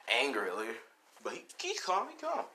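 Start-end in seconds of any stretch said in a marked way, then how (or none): none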